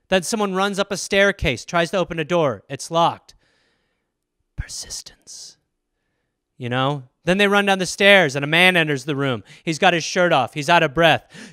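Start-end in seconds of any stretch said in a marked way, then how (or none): 4.6-5.6 breathy whispered one-word tagline; dramatic ad whisper. Pretend. Perfume commercial tagline, dramatic whisper.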